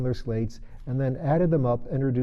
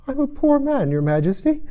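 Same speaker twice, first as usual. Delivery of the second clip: trembling voice; 6 dB louder than usual. Acted. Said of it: "in a trembling voice".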